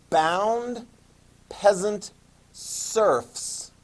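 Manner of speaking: peasant serfs drawn out very slowly; slow emphasis. Real, not acted. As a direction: stretch each word for the notes. Slow emphasis on key term.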